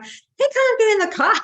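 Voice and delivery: high, loud imitation of incredulous husband; hammed-up, high-pitched disbelief; mock protest. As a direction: squeal the objection as the husband. Husband objects from the car.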